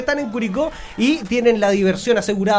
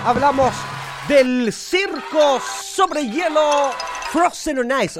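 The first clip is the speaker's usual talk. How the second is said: drawn-out, raised showman announcement; announcer introduction; 5 semitones higher, much slower than usual. Acted. Host presenting the ice circus to radio listeners, grand announcement. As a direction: stretch it out like a ringmaster's billing.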